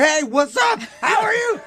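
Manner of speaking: high, shouted overexcited hello; excited greeting. Retold or imitated. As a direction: play a guy bursting with excitement. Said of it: "I expected him to be like".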